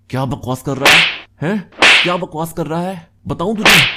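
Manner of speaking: loud, high, threatening challenge; angry confrontation. Acted. Abhay confronting the friend who mocked him, angry and loud. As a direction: raise the voice and square up for a fight.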